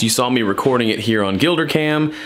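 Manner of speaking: ordinary talk, nothing marked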